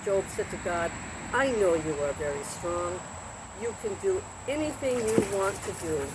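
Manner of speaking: lively storybook reading with voiced characters; reading to children. A narrator's reading. Narrator reading a Bible story to children, lively. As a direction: read the Job story to kids like a bedtime tale.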